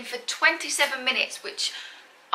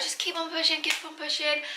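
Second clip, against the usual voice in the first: supportive urging; 6 semitones higher than usual. Retold or imitated. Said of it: "They were so supportive".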